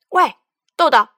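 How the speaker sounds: loud call with a pause after 喂; child shouting at the boxes of beans. Acted. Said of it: "大喊着".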